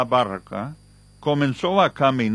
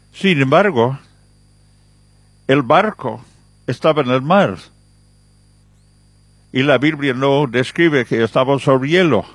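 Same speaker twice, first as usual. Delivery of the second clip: louder preaching that builds the argument against the scientist; forceful preaching; 5 dB louder than usual. Acted. Preacher refutes the ice theory to his listeners, forceful preaching. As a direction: press the point home to the congregation.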